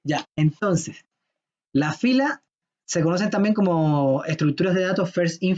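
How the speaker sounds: ordinary talk, nothing marked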